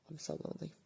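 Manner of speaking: soft, breathy sigh, then quiet and sad; lonely sigh. Real, not acted. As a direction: let it slip out on a sigh. Grieving owner alone after loss.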